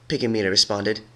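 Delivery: usual speaking voice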